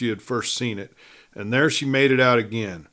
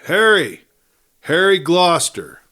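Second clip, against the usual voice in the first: loud high call into the dark; calling out loudly; 6 semitones higher, 7 dB louder than usual. A narrator's reading. Joan calling into the empty camp, loud high call. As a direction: call his name across the rocks. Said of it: "called out loudly".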